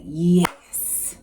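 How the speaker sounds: loud, drawn-out, hissing yes; a gambler relishing his bet coming good; retold cheer. Gamblers seeing their man get up, mock triumphant cheer.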